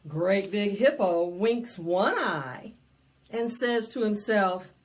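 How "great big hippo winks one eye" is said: plain reading voice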